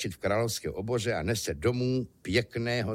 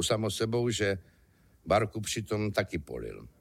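plain reading voice

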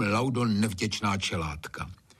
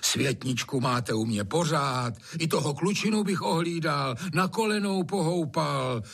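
angelic coaxing; 7 semitones higher than usual. A narrator's reading. Master talks his way back in, high angelic coaxing. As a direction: coax them with an angel's voice. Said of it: "vemlouvá se hlasem andělským".

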